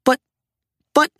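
small halting protest in a character voice. A narrator's reading. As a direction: stammer out a protest. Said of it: "Little Toot protested".

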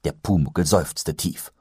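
plain reading voice

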